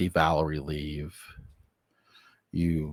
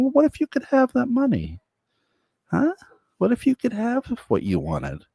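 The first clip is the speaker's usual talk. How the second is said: a much higher, wheedling devil voice; high tempting devil; 13 semitones higher, 4 dB louder than usual. Acted. Devil coaxing the diner woman, high and wheedling. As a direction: tempt her sweetly from way up high.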